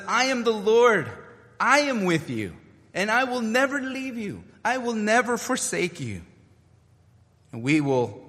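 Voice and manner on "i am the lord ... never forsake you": much higher, ringing, NEVER hit hard; preacher voicing Christ's promise to the congregation, ringing; fervent declaration. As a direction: proclaim it as Christ's own promise. Voicing Jesus' promise, rising.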